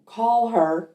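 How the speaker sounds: half-yelled retold command; retold yelling. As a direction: imitate a man calling out insistently. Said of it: "I hear the man yelling again".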